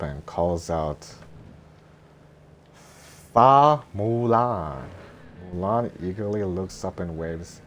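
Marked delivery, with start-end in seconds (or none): 2.7-4.9 slow, loud drawn-out call. Acted. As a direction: call the name out slowly. Matchmaker calls out name.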